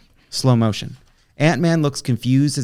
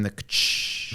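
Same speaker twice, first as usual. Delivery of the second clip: whispered ka-ch click; soft mouth-made mechanical click; imitated game click; 4 semitones lower, 10 dB quieter than usual. Shown as a demonstration. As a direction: mimic the VATS targeting noise under the breath. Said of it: "you know that noise".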